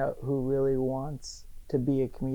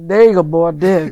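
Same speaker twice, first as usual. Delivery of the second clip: egging-on guy voice; 12 dB louder than usual. Pretend. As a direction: bark it like a rowdy onlooker. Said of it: "I feel like I'm that guy, like".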